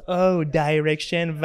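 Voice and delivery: drawn-out, very slow sneering Oh, direction; mocking friend. Imitating a teasing friend who mocks the pronunciation, very slow. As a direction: mimic a friend teasing the English word. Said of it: "این دوست کسخل من که اینجا نشسته".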